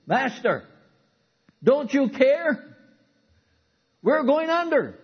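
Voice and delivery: high, loud, slow desperate plea; panicked cry. Acted. Disciples waking Jesus in the storm, panicked high cry. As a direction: cry out as the boat sinks.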